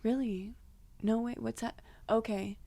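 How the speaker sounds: soft, hesitant feigned cluelessness; nice, playing dumb. New clerk stalling a difficult guest, nice and playing dumb. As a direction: gently stall with pauses, as if unsure. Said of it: "I just, nice as possible".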